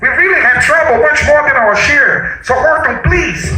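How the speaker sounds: high, urgent little voice; pleading. Acted. Mayor begs Horton for help, high pleading. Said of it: "pleaded the voice of the mayor".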